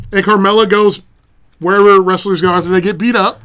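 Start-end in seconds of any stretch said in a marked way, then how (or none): none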